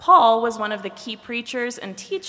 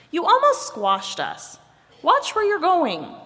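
high-pitched storybook telling-off; scolding; 9 semitones higher, 5 dB louder than usual. A narrator's reading. Head tells Body off for landing on top, scolding. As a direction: scold someone who nearly flattened you.

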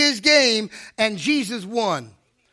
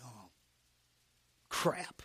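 very quiet, low mutter; hushed, resigned oath; sinking realization; 11 semitones lower, 14 dB quieter than usual. Retold or imitated. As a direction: let it slip under your breath in dismay.

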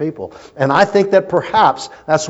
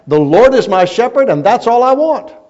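put-on higher, louder proud child reciting; proud little girl; 11 semitones higher, 8 dB louder than usual. Sunday school girl reciting her verse to the class, proud and loud. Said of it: "She stands up very proudly".

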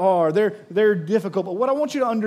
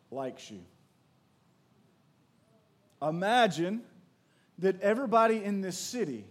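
softer, unhurried guiding voice with long pauses; guided imagining; 7 dB quieter than usual. Acted. Pastor guiding the congregation to imagine being loved, softly. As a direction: lead them gently into the picture.

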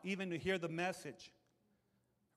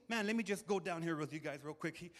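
usual speaking voice

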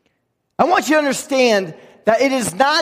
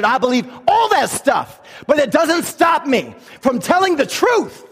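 preaching at full force; 3 semitones higher than usual. Acted.

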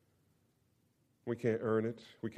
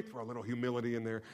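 ordinary talk

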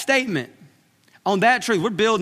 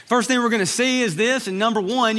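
normal talking voice

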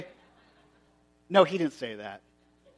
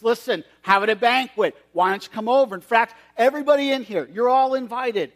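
higher and louder, eager; excited invitation; 5 semitones higher, 6 dB louder than usual. Acted. Servant excitedly inviting everyone at the hairdressers. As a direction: call everyone in with enthusiasm.

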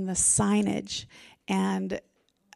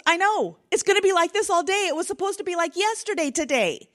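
higher, faster, louder daughter voice; imitating daughter; 9 semitones higher, 5 dB louder, faster than usual. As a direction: rattle it off high like a grumbling kid. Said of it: "And she goes".

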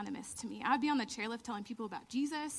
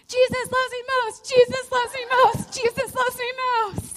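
much higher, loud chanted shout; yelling; 12 semitones higher, 13 dB louder than usual. Retold or imitated. Yelling to Jeremy and friends after winning, loud joyful chant. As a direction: chant it at your friends, overjoyed. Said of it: "I'm yelling".

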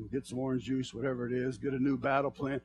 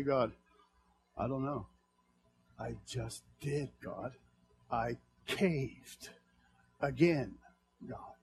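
1 quiet sorry confession; 4 semitones lower, 8 dB quieter, slower than usual. Pretend. Own prayer of failure.

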